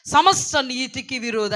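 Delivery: loud, slow accusation; thundering preacher's denunciation; fiery rebuke. Acted. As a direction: declaim it forcefully. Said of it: "ఏమని మాట్లాడుతున్నారో ఇక్కడ".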